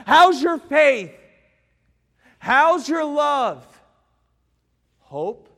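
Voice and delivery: loud, high, slow preaching cry; impassioned preaching. Acted. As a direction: hammer the question home. Preaching climax.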